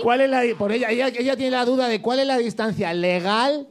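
agudo y entre risas; riendo. Real, not acted. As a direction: se ríe mientras lo repite. Riendo repite la pregunta. (repitiendo la duda entre risas)

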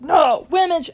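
mock insistence. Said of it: "if they're like".

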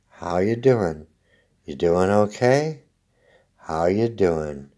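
low, slow creepy check-in; creepy low concerned voice. Retold or imitated. Creepy repeated neighbor question.

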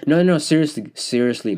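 insistent. Real, not acted. Host insisting listeners truly cry it out, urgent and loud. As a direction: press it home, you really mean it.

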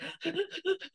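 a sharp, high gasp for air; re-enacted panicked gasp. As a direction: gasp like you can't catch your breath.

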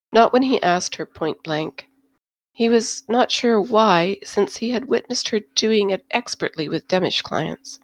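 ordinary delivery

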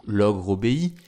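usual speaking voice

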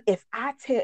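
ordinary delivery

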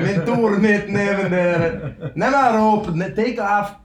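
put-on high, loud, slow Yiddish accent; loud mock Yiddish teacher. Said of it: "a Yiddish, Yiddish accent?".

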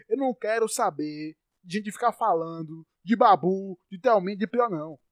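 loud furious outburst; real anger, shouting, accent breaking through; pissed-off yelling at the housemates; angry rant. Real, not acted. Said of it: "meu sotaque só aparece quando eu tô puto".

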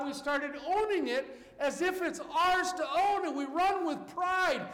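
rising to a loud, high preacher's call; fervent preaching. Acted. Preacher urging the congregation, full-voiced fervent preaching. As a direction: preach it with mounting fire.

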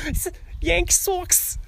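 very high, slow cartoon Boston accent. Joking as a baseball fan, exaggerated high Boston accent. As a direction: squeal it with stretched Boston vowels. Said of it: "do a Boston accent".

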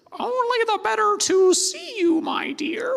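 very high, falsetto granny voice; a wolf squeaking sweetly as grandmother; wolf disguised as grandma. Acted. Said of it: "And the wolf says".

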